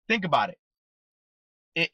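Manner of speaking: usual speaking voice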